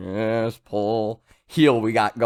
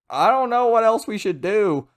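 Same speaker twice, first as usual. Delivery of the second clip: high-pitched mock helplessness; raised, put-on voice pleading ignorance; clueless crew member; 10 semitones higher, 3 dB louder than usual. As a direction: act out a crewmate at a total loss.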